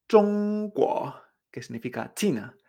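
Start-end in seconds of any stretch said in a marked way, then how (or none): 0.1-1.1 ZHONGGUO, primera sílaba alta y plana, segunda ascendente; mantén ZHONG arriba y sube en GUO; tono uno dos. Shown as a demonstration. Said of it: "El tono uno dos". («zhongguo»: tono alto y luego subida)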